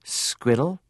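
"squiddle" with a d sound and a lilt up; Liverpool pronunciation. Retold or imitated. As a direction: imitate a Liverpool dad saying "squiddle". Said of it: "He would say the word, word squirrel".

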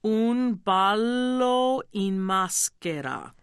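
"Un ballo in maschera" drawn out slowly, syllable by syllable; very slow, Italianate "Un ballo in maschera"; slow pronunciation demo. Shown as a demonstration. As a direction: model "Un ballo in maschera" for a learner in careful Italian. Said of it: "I'll do it slowly".

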